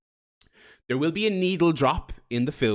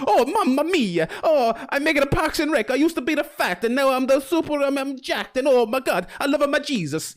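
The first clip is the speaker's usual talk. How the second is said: very high, loud cartoon Italian accent; wacky fake Italian; 14 semitones higher, 6 dB louder than usual. Streamer mocking the idea of Pratt's Mario, wacky Italian accent. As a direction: squeal it out in a hammed-up Italian Mario voice. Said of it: "he will do a fake Charles Martinet wacky Italian voice".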